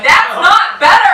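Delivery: slow, drawn-out indignant retort; flat retort. Retold or imitated. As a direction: drag out the offended correction, laughing. Deadpan retort to friend.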